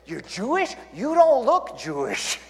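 put-on high, slow, accented punchline; Chinese accent punchline. Pastor delivers joke punchline in Chinese accent. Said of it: "thick Chinese accent".

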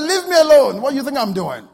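very loud, high acted yell; indignant, high-pitched shouting; exasperated yell. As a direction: yell full force at a pest. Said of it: "And he yelled again".

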